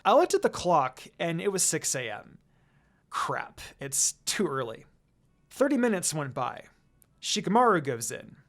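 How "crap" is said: usual speaking voice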